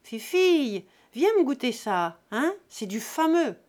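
put-on loud, jovial drunken host; boisterous drunk. A narrator's reading. Drunk Jésus-Christ ordering a feast from the landlady, booming. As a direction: play the big spender, loud. Father offering drink, loud.

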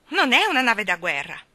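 loud, sharp scolding; admonishing. A narrator's reading. Mary Poppins protects the baby from crowding, admonishing. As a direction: tell them off firmly. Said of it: "li ammonì".

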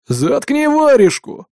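high, drawn-out angry yell; growled order. A narrator's reading. Gang boss silences the chattering captive, high angry growl. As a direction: shut him up angrily, stretching the words. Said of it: "прорычал главарь".

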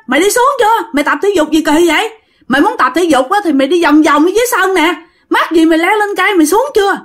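high, fast scolding call upward; shouting up. Retold or imitated. Comrades below the tree shouting up at the injured soldier, in a high, fast scolding call. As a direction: yell at him to get down the tree.

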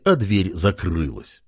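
plain reading voice

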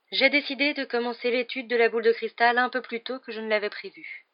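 high-pitched, theatrical seer; mysterious professor. Acted. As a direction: announce it like an otherworldly fortune-teller.

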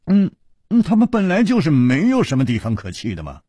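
high, loud, quick protest; exasperated sigh into a hurried defense. A narrator's reading. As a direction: insist on it, pitched high and loud. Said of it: "楚留香叹了一口气说".

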